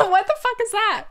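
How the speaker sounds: high, loud shocked squeal. Retold or imitated. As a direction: shriek at your own voice. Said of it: "I'm like".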